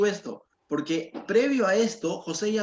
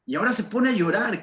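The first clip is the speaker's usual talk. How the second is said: ordinary delivery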